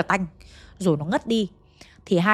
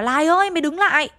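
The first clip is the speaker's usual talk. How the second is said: higher, louder shout to stop her; yelled call; 7 semitones higher, 4 dB louder than usual. Retold or imitated. Lộc yelling at Lài running to the water, loud shout. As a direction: shout after her to stand still. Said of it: "Anh gào lên".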